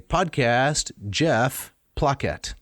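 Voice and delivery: each Latin word said slowly and carefully for learners; slow Latin demo. Shown as a demonstration. Teacher to co-host, slowly modelling a Latin sentence. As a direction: spell out "Podcast Jeff placet" slowly, word by word. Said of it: "right, I can say".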